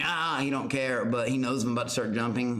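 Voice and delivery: ordinary delivery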